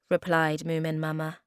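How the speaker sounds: plain reading voice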